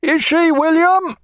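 high, loud, slow call up to the masthead; officer calling up. Acted. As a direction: shout the question up the mast. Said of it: "called the officer of the watch".

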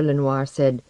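ordinary delivery, nothing marked

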